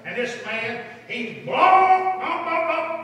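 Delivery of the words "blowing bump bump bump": high, sustained honking imitation; loud "blow", then sung-out bumps like a horn; imitated car horn. Preacher acting out a driver blocking the hospital, imitated horn honking. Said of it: "that horn".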